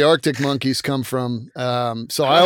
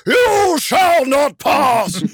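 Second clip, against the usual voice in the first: booming slow wizard shout; Ian McKellen as Gandalf; 11 semitones higher, 4 dB louder, much slower than usual. Retold or imitated. A booming wizard shout at the podcast hosts. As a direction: bellow it like a wizard barring the bridge. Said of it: "While I don't sound like Ian McKellen".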